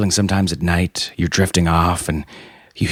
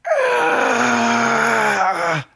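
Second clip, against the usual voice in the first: laughing shriek; much slower than usual. Real, not acted. Laughing outburst.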